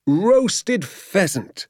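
high, long-drawn exclamation; rapturous cry. A narrator's reading. Father addressing the moon, rapturous cry. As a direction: proclaim it slowly to the whole countryside. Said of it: "he cried out".

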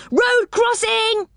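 high, drawn-out warning call; warning cry. A narrator's reading. Rick warning Joe of the shut gates, high call. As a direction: shout it down the track. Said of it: "he cried".

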